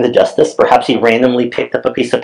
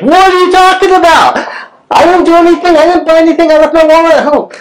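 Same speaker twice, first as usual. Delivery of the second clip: high, louder retold shout; yelling back; 4 dB louder than usual. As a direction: shout back in heated self-defence. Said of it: "I'm just yelling right back at her".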